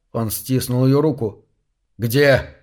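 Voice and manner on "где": ordinary delivery, nothing marked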